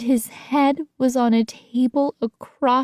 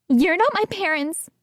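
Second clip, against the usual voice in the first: trembling voice; 7 semitones higher than usual. A narrator's reading. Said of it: "my voice trembling".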